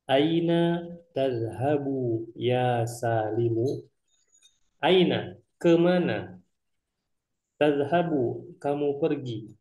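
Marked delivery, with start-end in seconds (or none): 0.1-3.8 slow, careful Arabic read-aloud; slow dialogue reading. Shown as a demonstration. Teacher reading Qasim's Arabic line to students, slow and careful. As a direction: spell each Arabic word out slowly for learners. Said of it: "Qasim di sini bilang".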